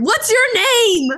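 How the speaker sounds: high, loud call across a room, drawn out; retold yell. Calling to the chef across the dining room, a loud yell. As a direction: shout the question slowly across the room. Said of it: "I was, like, yelling through the dining room".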